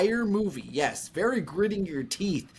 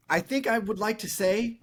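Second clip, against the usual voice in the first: ordinary talk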